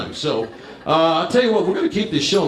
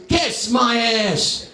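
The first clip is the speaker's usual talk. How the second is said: slow, drawn-out, pitched-up angry wife; pissed wife; 6 semitones higher, much slower than usual. Retold or imitated. Comic retells his angry wife's slow comeback. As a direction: spit it out like the furious wife. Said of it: "She goes".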